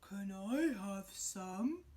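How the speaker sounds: lumber through it like a big bear; slow gentle bear. Acted. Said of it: "asked the bear".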